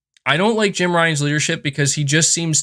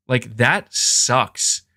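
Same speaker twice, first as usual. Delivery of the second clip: slow disgusted repeat; 2 semitones lower, much slower than usual. Retold or imitated. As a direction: drag out that sucks slowly. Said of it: "And I'm like".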